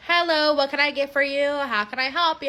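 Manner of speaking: lightly chirpy order-taker voice; customer service voice. Retold or imitated. Cashier greeting a customer at the speaker, lightly put-on service voice. Working the drive-through speaker.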